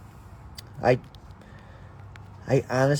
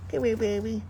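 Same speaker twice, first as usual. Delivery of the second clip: sweet nothings; 10 semitones higher than usual. Real, not acted. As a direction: coax a pet in gently. Coaxing chipmunk.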